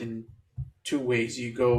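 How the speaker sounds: ordinary talk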